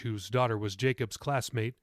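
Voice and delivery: usual speaking voice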